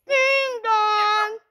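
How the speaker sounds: high loud sing-song ding dong; doorbell ding dong. Pretend. Kid voicing the doorbell as visitors arrive, loud chime. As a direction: ring it out like a doorbell.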